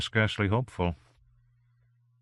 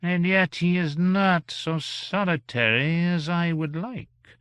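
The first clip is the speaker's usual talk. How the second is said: high, slow, displeased gentleman; fussy disapproval, pitched high; 8 semitones higher, slower than usual. A narrator's reading. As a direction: draw out the displeasure in a raised voice. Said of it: "remarked the gentleman in a displeased tone".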